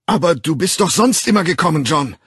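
high, loud, coaxing shout; pleading shout. A narrator's reading. Bernard shouting his plea through the door, high and loud. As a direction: call him out, still begging.

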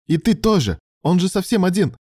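high, loud, pleading anger; worked-up indignant cry; heated protest. A narrator's reading. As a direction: keep the outburst going. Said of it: "вспылил Алан".

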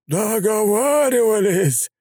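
high, very slow mocking echo; mocking repeat. A narrator's reading. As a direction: throw his word back at him.

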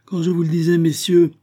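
exclaiming. Acted. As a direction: fling it out in outrage. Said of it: "s'écria Delaunay".

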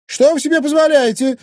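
very high, loud indignant cry; outraged. Acted. Wife insulted by intruders, outraged. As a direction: exclaim in shrieking outrage. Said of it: "возмутилась Анфиса".

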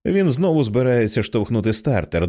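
ordinary delivery

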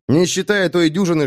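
ordinary delivery

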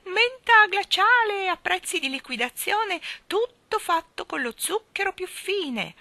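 high, slow, far-off hawker's call; street vendor's cry. A narrator's reading. Miss Calicò calls her sale from far below, high and drawn out. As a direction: sing out the sale like a faint vendor. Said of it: "la voce della signorina Calicò".

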